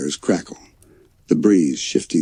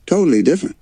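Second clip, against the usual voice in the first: defensive; 12 semitones higher, 3 dB louder, much faster than usual. A narrator's reading. Grizz defending himself.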